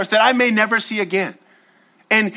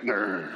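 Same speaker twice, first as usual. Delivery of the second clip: mock growl; 9 dB quieter than usual. We growl at God like dog.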